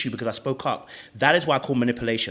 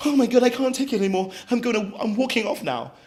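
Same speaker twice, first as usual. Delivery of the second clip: high, loud hammed-up exasperation; mock victim; 9 semitones higher, 4 dB louder than usual. Mocking Sharice suddenly wanting to walk off, dramatically. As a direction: pretend to be overwhelmed, voice pitched up. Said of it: "you're telling me all of a sudden".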